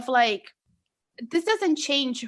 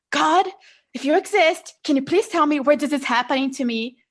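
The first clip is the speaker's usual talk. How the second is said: loud, demanding cry at God; angry plea to God; 4 semitones higher, 5 dB louder than usual. Retold or imitated. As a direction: demand answers from God, furious. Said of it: "And I was so angry".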